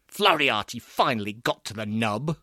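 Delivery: usual speaking voice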